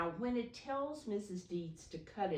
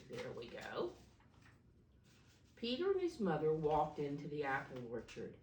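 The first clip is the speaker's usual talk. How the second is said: ordinary delivery, nothing marked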